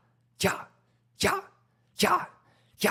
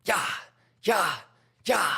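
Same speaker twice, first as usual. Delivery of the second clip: normal talking voice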